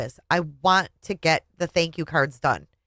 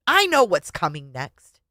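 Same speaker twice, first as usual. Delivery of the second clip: plain reading voice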